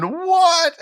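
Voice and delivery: very high, loud disbelieving what; shocked what. Retold or imitated. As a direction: yelp it in shock. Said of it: "I'm sitting there going".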